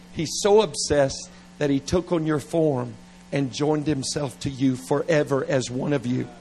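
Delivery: usual speaking voice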